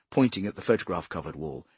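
ordinary delivery

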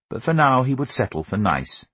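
ordinary delivery, nothing marked

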